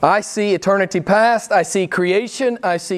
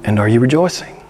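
emphatic challenge; 5 semitones lower, 6 dB louder than usual. Acted. Challenging congregation loudly.

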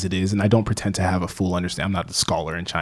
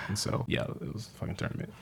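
deadpan aside; 15 dB quieter than usual. Real, not acted. Deadpan punchline, very soft.

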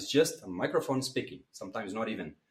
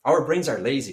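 louder; 2 semitones higher, 9 dB louder than usual. Shown as a demonstration. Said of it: "it's about the transition".

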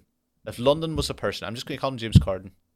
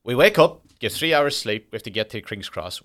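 ordinary delivery

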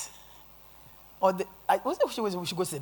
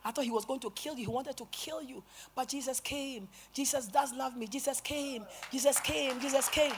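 higher, hushed repeated testimony; relieved woman; 4 semitones higher, 6 dB quieter than usual. Retold or imitated. Freed woman telling preacher how Jesus came, relieved.

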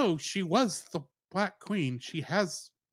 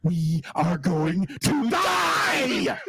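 slow, laughing shout of doom; Indiana Jones quote; much slower than usual. Retold or imitated. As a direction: drag it out in mock panic while laughing. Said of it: "To quote Indiana Jones".

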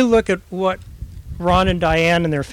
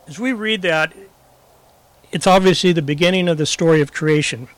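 ordinary delivery

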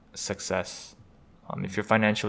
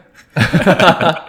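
big burst of laughter; loud laugh; 8 semitones higher, 16 dB louder than usual. Real, not acted. Laughing at friend's claim.

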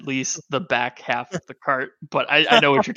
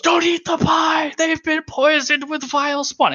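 very high-pitched mock scream, held on one note; retold booming scream. Game master echoes character warning the festival, high mock scream. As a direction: imitate a magnified warning shrieked over a crowd. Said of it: "And so I'll, I'll scream".

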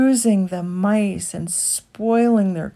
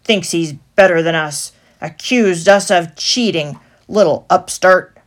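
louder, snooty rat; sniffy disdain; 10 dB louder than usual. A narrator's reading. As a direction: look down your nose. Chief rat sneering.

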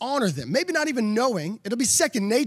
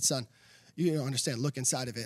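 gentle dad voice; 7 semitones lower, 7 dB quieter than usual. Retold or imitated. Pastor dad answers disappointed son.

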